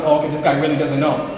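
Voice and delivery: usual speaking voice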